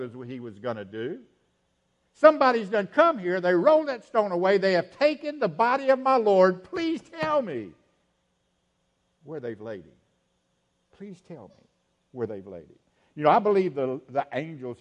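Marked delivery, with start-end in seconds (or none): 2.1-7.7 loud, higher, pleading outburst; urgent plea. Acted. Mary begging the angels for her Lord's body, urgent plea. Preacher voices distraught Mary at tomb. 9.2-12.8 soft, low pleading after an outburst; subdued plea. Acted. Mary begging to know where they laid him, subdued plea.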